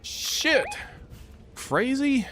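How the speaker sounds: high startled exclaims; startled high yelp. Real, not acted.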